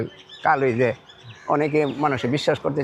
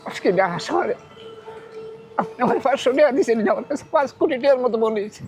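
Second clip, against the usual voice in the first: high voice, really crying; tearful; 5 semitones higher than usual. Real, not acted. Poor food seller explaining tears of joy to benefactor, tearful. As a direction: speak through real tears, pitched up. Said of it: "কান্না করতেছেন কেন".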